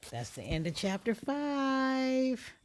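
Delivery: slow, loud sign-off announcement; upbeat narrator sign-off; outro. Real, not acted. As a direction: announce the chapter end. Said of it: "outro announcement".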